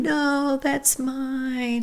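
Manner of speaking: put-on high, soft little-girl voice, lightly done; a small daughter insisting the shirt is hers; imitating a little girl protesting. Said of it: "And you'd say".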